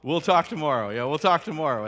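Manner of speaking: loud clipped sign-off; mock brusque reply; curt and brisk. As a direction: shut the talk down fast. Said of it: "what do I say?".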